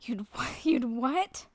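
slow, breathless whispered disbelief; shocked whisper. Real, not acted. Shocked by friend's filthy suggestion.

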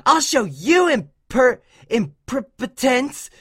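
high, slow, breaking off at "imper-"; stumbling threat. A narrator's reading. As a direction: trip over "imperpotence" piece by piece, slowly. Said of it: "Dash tried to say".

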